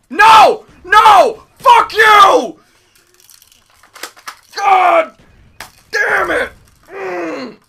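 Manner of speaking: loud high swearing yell; raging shout. Real, not acted. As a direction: curse at the screen. Raging at game.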